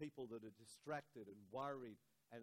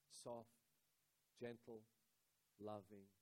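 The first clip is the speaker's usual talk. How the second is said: softer, tender words; soft and loving; 4 semitones lower, 6 dB quieter, slower than usual. Shown as a demonstration. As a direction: breathe each word gently. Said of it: "I said loving".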